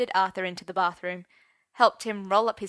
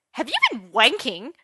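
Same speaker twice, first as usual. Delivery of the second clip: high, loud scandalised yell; 4 semitones higher, 8 dB louder than usual. A narrator's reading. Merlin catching Arthur fastening his flies, scandalised yell. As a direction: yell the question in disbelief. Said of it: "Merlin yelled".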